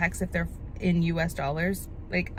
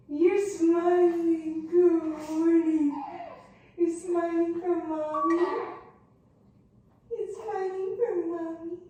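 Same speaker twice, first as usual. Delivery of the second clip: high, slow sing-song coo; 10 semitones higher, slower than usual. Real, not acted. Cooing to her smiling baby.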